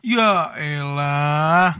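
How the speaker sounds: long, drawn-out exasperated sigh of words; very slow fed-up drawl. Real, not acted. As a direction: let it sag with disbelief. Exasperated comic groan.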